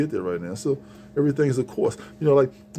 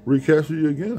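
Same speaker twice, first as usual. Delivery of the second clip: ordinary talk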